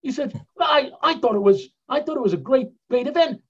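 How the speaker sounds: British accent, hammed-up and high. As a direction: imitate an English traveller's surprise. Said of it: "they really do talk like that".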